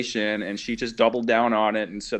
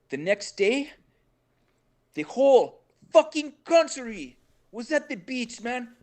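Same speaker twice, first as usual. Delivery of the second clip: imitating Humberto, much higher and slower, half in Spanish; fired up; 13 semitones higher, slower than usual. Venezuelan guy raving to the host about the beach party, fired-up high voice. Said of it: "And he was fired up".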